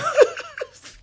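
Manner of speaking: loud high laughing burst; burst of laughter. Real, not acted. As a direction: let a big laugh explode.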